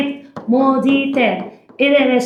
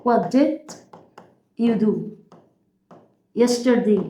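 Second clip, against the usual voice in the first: word-by-word dictation; 2 semitones lower, 5 dB quieter, much slower than usual. Shown as a demonstration. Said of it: "اكتب ورايا".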